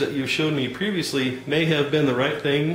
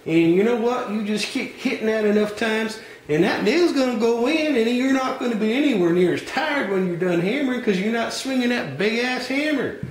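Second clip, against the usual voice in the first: higher, folksy Southern salesman voice; folksy hardware clerk; 9 semitones higher than usual. Acted. Clerk pushing the wrong tools on a customer, folksy higher voice. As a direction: play the pushy country store clerk.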